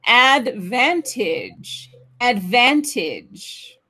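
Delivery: ADVANTAGE slowly, stress on the second syllable "van"; slow model word. Shown as a demonstration. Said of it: "The stress is on the second syllable".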